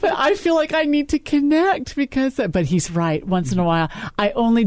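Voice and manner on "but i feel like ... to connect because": high mock-pleading voice; half-joking whine in self-defence; plaintive protest. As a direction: plead in a raised, self-mocking pitch. Keeps up her mock plaintive protest.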